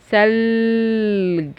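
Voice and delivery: ثلج with the L held very long; drawn-out L. Shown as a demonstration. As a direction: slowly hold the middle L of ثلج. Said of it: "بحرف اللام". (مد صوت اللام في ثلج)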